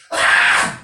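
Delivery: explosive loud fan scream; mom's shriek. Retold or imitated. Comic imitating his mom as Elvis stands still, loud shriek. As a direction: scream as if overwhelmed by an idol. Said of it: "even my mom was like".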